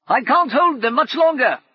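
high, loud strained call. Acted. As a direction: shout up while holding the door. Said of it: "Brumman called".

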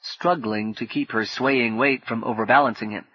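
usual speaking voice

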